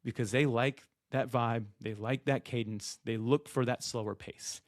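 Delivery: slow, soft, measured cadence. Shown as a demonstration. Guest mirroring a slow-talking host, calm and slowed down. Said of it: "I'm gonna be a little bit calmer".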